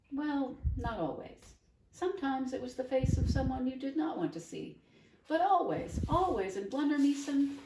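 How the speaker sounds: put-on an old woman full of feeling; voiced old woman rhapsodizing about her homeland; effusive old lady. Doctor Measham's character voice.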